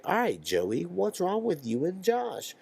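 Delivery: lightly voiced mediator, drawn-out high question; kid mediator. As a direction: slowly ask like a peer mediator, lifting high at the end. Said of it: "the mediator".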